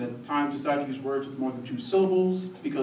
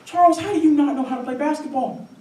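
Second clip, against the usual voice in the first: high-pitched mock puzzlement; imitated acquaintance; 11 semitones higher, 4 dB louder than usual. People quizzing Charles on basketball, high mock puzzlement. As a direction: lightly mimic a baffled acquaintance. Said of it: "It's like when people say".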